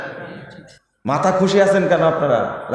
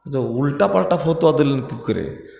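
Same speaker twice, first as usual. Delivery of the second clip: normal talking voice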